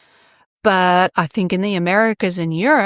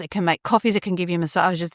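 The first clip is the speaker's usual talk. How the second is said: ordinary delivery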